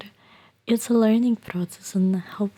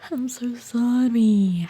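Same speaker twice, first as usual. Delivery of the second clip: usual speaking voice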